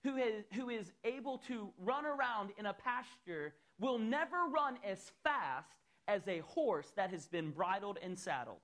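fervent full-force sermon voice; preaching. Acted. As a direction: preach it out, high and loud. Preaching to congregation, raised voice.

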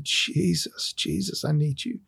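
soft broken plea, second Jesus whispered; a quiet desperate cry for help; retold crying plea. Man alone in dorm room pleading with Jesus, softly broken.

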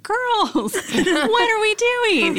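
squeaky high frustrated teacher voice. Retold or imitated. Leader to girls who rearranged tables, voice shooting high. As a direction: pitch jumps way up in frustration. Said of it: "my tell is when I'm frustrated, my voice goes up".

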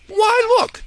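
put-on very high surprised old angel; an angel exclaiming over the notes; high squeaky angel exclaiming. Clarence reads his pad.

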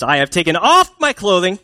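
put-upon protest with high punched stresses; exasperated refusal. Acted. Wife refusing her husband at the door, exasperated. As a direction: act out an indignant wife balking. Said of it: "put these next few verses in the tone that I think she's saying this in".

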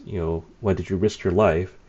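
usual speaking voice

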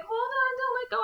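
high, sing-song hammed-up plea; mock dramatic plea. Friend mocking the movie's couple, high sing-song plea. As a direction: ham up a melodramatic cry. Couple clinging in earthquake scene.

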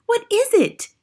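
very high, startled cry. A narrator's reading. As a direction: cry out the question in alarm. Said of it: "the others cried out".

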